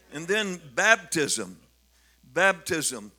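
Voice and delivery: slow, loud stress on baptism; emphatic preaching. Acted. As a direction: hammer the word home.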